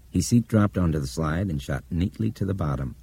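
plain reading voice

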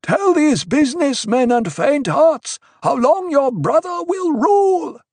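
very high, loud demand; insistent heckler bellowing; called out. A narrator's reading. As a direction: shout it up to the priestess. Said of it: "a man directly in front of Paul called".

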